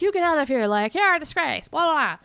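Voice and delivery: high-pitched mock telling-off; mock yelling. Friends yelling at the tramp, mock yelling. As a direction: scold loudly, half in fun. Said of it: "but they're yelling at".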